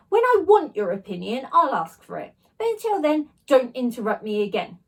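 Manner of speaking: loud teacher's shout; furious teacher shouting at a child; angry shout. A narrator's reading. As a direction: bark it down at a cheeky pupil. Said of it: "shouted Mister Irons".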